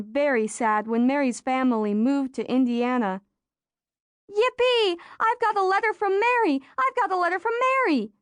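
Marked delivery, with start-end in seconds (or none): 4.3-5.0 high, thrilled squeal of joy; excited shriek. A narrator's reading. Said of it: "Rachel shrieked". 5.2-6.6 high, joyful cry, landing hard on Mary; thrilled announcement with the stress on Mary; excited shriek. A narrator's reading. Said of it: "Rachel shrieked". 6.8-8.1 high and fast, tumbling out with joy; fast excited shriek. A narrator's reading. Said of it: "Rachel shrieked".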